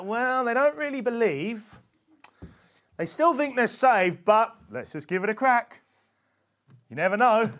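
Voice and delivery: mock casual doubt. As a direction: imitate someone shrugging off the doubts. Mocking half-hearted soul-winners giving it a go.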